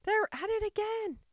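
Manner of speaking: high-pitched, loud acted shout; top-of-voice shout. A narrator's reading. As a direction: shout the news at full pitch. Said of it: "shouted at the top of his voice".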